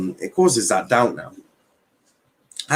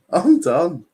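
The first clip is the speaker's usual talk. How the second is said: laughing; 7 semitones higher than usual. Real, not acted. Host still laughing.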